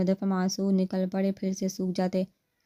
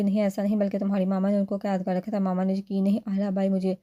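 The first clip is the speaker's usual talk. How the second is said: ordinary delivery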